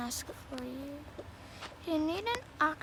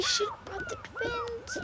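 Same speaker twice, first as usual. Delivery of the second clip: squeaky voice; slower than usual. Acted. Parent regrets leaving twins.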